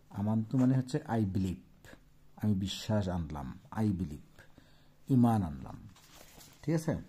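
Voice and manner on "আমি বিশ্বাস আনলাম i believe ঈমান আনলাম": normal talking voice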